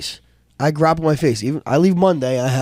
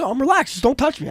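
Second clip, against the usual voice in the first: retold agitated protest; 11 semitones higher than usual. Retold protest to security guard.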